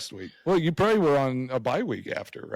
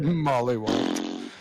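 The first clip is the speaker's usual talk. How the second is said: amused repeat; much slower than usual. Real, not acted. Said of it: "That's my new favorite word".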